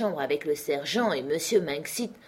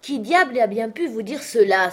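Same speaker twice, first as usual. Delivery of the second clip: exclaiming; 5 semitones higher, 6 dB louder than usual. A narrator's reading. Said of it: "s'écria mon oncle".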